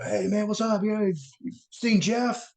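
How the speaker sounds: high called-out question; over club noise. Retold or imitated. Asking a friend in a packed club, high called-out question.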